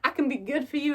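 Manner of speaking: a hint of a breathy damsel plea; mock pleading heroine. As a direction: play the eager captive, hammed-up. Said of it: "she's kind of like".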